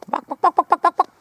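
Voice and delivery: high clucking bok bok; chicken clucking. Pretend. Imitating chickens.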